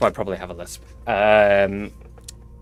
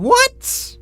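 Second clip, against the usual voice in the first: startled. Real, not acted. Stream broke, startled.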